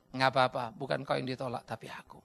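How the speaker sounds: calm him down gently; God's reassurance. Acted. God comforts Samuel, low soft reassurance. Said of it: "God answers Samuel".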